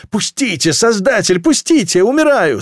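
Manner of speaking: high, rising panicked cry; desperate pleading. A narrator's reading. Person being crushed begging to be let out, desperate.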